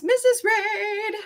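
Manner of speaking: very high, slow drawn-out sing-song name. Real, not acted. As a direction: stretch her name like a tune. Sing-song repeat greeting.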